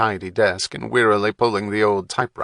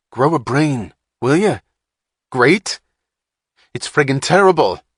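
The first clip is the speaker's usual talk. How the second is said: louder, higher exasperated jab; scornful outburst; 5 semitones higher, 6 dB louder than usual. A narrator's reading. Wapner mocking Hatch's great, scornful outburst. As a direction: sneer the word great back.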